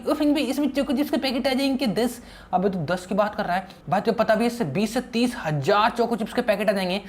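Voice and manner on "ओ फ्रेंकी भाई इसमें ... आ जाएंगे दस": childish cartoon-boy voice, a little higher; Sinchan voice. Sinchan teasing his buddy Franky, in a childish cartoon voice. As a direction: play the cheeky cartoon kid. Sinchan dreams of choco chips.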